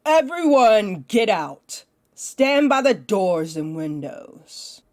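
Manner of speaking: loud order to the whole room, slow at the end; commanding call. Acted. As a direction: command your thralls to clear out. Vampire commands her thralls.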